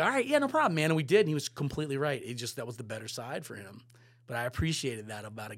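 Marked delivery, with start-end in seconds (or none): 0.0-0.9 high, loud, eager agreement; mock eager yes. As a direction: play the overeager yes-man.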